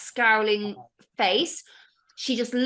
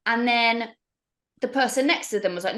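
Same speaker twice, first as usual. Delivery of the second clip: ordinary talk, nothing marked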